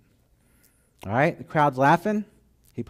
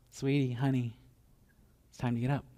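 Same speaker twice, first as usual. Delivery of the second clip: soft and coaxing, voiced; gentle bedside nudge; tender wake-up; 5 dB quieter than usual. Parent waking daughter.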